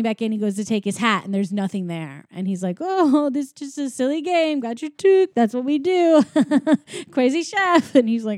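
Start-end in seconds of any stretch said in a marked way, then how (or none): 2.8-7.9 high, laughing cover-up; light, raised voice with a giggle; mock playful. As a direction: play it off as a silly game.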